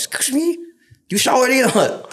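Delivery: high indignant mock challenge. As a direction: confront them with exaggerated offence. Annoyed at bunkmates on bed.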